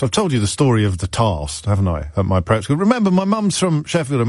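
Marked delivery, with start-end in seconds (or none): none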